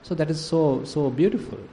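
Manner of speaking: ordinary talk, nothing marked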